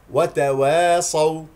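"وتواصوا" slow and loud, thin wae; correct light و. Shown as a demonstration. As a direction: keep و light before heavy ص in "وتواصوا". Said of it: "لا تستعلي". (ترتيل "وتواصوا" بواو مرققة)

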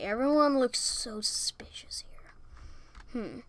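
slow suspicious whisper. Real, not acted. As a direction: hush it while sneaking. Sneaking among suspects.

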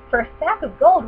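put-on children bargaining with the witch, thrilled; excited. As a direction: push it out with greed. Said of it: "they cried".

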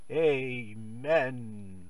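ordinary talk

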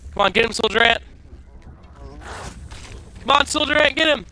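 high, loud egging on; cheering on. Real, not acted. Gamer cheers soldier ant on, high excited urging. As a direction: urge the ant to attack.

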